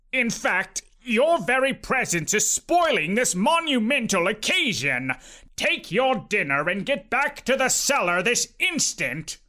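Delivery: stern uncle. A narrator's reading. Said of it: "pointing a stern finger".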